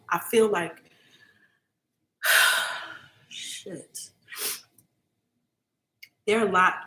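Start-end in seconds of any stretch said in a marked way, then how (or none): 2.2-4.0 overwhelmed. Real, not acted. Overwhelmed during emotional reading.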